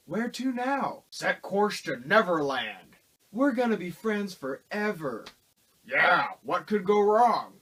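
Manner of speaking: hammed-up cheesy movie lines; sarcastic reading of bad film lines; mocking movie dialogue. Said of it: "this is what the dialogue was".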